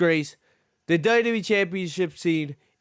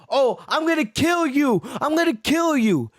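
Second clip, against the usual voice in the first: mock yell; 5 semitones higher than usual. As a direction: ham up the angry threat, raised pitch. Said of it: "he's just yelling".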